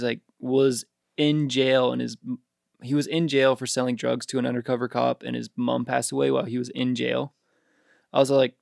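ordinary talk, nothing marked

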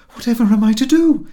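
high, loud cry of despair; panicked cry. A narrator's reading. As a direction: cry out, at a loss. Panicked wife in a mix-up.

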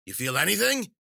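high, loud rising question; insistent witch. Acted. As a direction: press the patient in a crone voice. Witch tests patient, more insistent.

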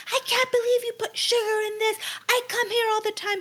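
high, sustained imitation of an outraged regular; mock freak-out. Imitating the customer who won't listen to staff, mock freak-out. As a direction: rant in a high mock-complaining voice. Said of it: "she's, like, freaking out".

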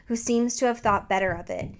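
usual speaking voice

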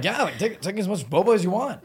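eager salesy voice; imitating eager shop owner. Boba shop owners quoted.

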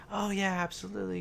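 bright, high helpful clerk; chirpy theme park guest services voice; customer service voice. Retold or imitated. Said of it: "the tone changes".

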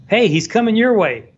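warning call. Retold or imitated. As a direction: call out a warning to the next boat. CNN guy warns about snake.

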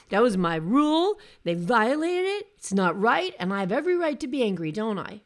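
loud mock outrage that speeds up toward the end; hammed-up angry rant. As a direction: imitate a survivor indignantly demanding agreement. Acted self-righteous survivor rant.

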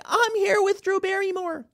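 high breathy mock voice; parody Dylan Mulvaney. Mocking Dylan Mulvaney on the talk show, high gushing parody. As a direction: squeal it like an excited fan. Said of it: "Dylan Mulvaney said, like".

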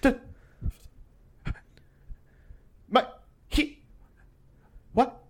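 a confused, lost voice, stumbling over words; dazed rambling. Retold or imitated. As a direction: play her as too high to finish a sentence. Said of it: "she's like".

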